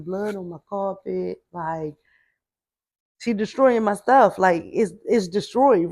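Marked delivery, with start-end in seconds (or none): 3.2-5.9 louder, aggrieved retelling; retold outrage. Venting about her ruined new bedroom set, mildly mad and loud. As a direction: relive being mad, pushing the volume. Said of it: "I'm so mad".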